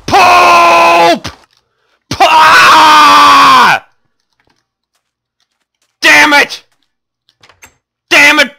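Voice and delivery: high loud yelps and curses; furious shouting. Real, not acted. Gamer losing his armor, loud furious shouting. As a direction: scream at the screen in frustration.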